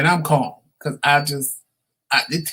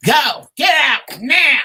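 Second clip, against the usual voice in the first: high, loud mock yell; retold yell; 9 semitones higher, 5 dB louder, slower than usual. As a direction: bark the order to leave. Husband yelling at wife, retold.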